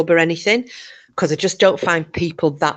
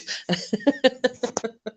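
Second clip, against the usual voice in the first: laughing; 8 semitones higher, 4 dB quieter than usual. Real, not acted. Laughs at punchline.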